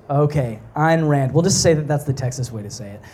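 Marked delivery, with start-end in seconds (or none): 0.7-1.3 Ayn said like "Ine"; corrected pronunciation. Shown as a demonstration. Preacher settling on the author's name, Ayn said as "Ine". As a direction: say Ayn to rhyme with "mine". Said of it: "I think I might have said it".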